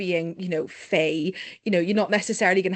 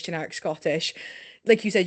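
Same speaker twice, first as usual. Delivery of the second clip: usual speaking voice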